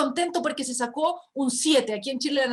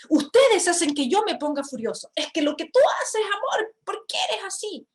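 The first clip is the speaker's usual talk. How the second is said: accusing blame; 6 semitones higher, 4 dB louder than usual. Shown as a demonstration. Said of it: "En vez de decir".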